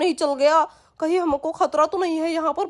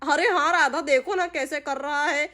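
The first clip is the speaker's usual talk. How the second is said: ordinary delivery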